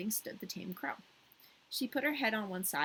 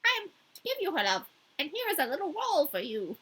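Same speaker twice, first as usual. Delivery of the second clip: a lightly voiced chatty crow, higher; 7 semitones higher, 4 dB louder than usual. The crow answering Gerda in a light crow voice. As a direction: croak it out as a talkative crow. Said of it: "difficult to enunciate in this voice".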